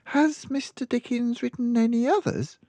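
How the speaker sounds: put-on child explaining her mother's habits; small girl. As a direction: speak like a matter-of-fact little girl. Girl asks eagerly.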